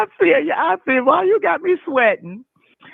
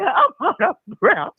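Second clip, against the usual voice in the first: laughing; 7 semitones higher than usual. Retold or imitated. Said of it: "I said".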